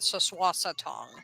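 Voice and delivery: "Soswasatong" with a rounded O in the last syllable; German O sound. Shown as a demonstration. Host demonstrating the plant's name, German O sound. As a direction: let an O come through at the end of "Soswasatong", soft G. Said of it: "O sound coming through".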